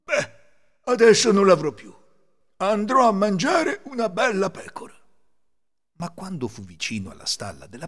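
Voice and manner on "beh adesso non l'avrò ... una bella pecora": loud, high, self-satisfied wolf; relieved wolf. A narrator's reading. Wolf rid of his wind, loud and pleased. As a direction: announce it to yourself with relish. Lupo sollevato parla tra sé.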